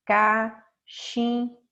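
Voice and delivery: "Cachimbo" drawn out as ca... chim... bo; very slow, evenly spaced syllables in "Cachimbo". Shown as a demonstration. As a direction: separate each syllable of "Cachimbo" with clear pauses. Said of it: "separar ela em sílaba".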